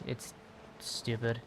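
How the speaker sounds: ordinary talk, nothing marked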